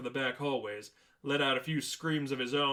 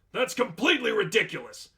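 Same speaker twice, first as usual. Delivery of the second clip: higher, louder scoffing dismissal; indignant; 8 semitones higher, 7 dB louder than usual. Acted. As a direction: throw it back as nonsense. Manager rejects demon claim.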